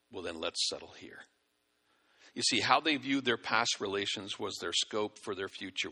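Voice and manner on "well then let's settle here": content settler. Retold or imitated. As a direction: lower and much softer, quietly pleased. Settlers' content reply voiced.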